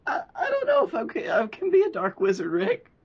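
very high anxious squeak; wobbly nervous stammer; Morty impression. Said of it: "Morty voice".

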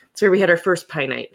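ordinary talk

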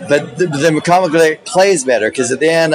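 usual speaking voice

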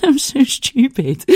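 laughing at herself. Real, not acted.

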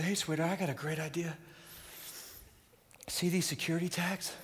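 mock secret plan to ditch the kids; conspiratorial hush. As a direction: lean in and plot in hushed tones. Husband plotting escape in service.

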